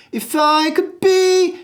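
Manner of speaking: be sung high and held, could thrown away; stress on be. Shown as a demonstration. Coach aiming the high note at the word be. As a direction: aim the note at be. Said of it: "you throw away the word could".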